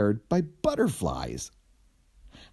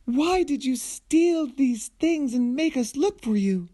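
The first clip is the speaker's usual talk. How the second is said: very high queen voice; queen confronting her daughter; queen scolding. Acted. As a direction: demand an answer like a shrill royal mother. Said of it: "asked the queen".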